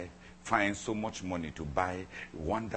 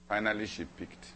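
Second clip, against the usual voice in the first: ordinary talk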